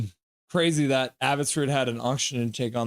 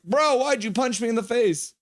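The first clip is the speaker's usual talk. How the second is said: mock stunned protest; 9 semitones higher than usual. Storyteller quoting himself after the punch, high and incredulous. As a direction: act out being shocked by the punch. His own stunned reaction quoted.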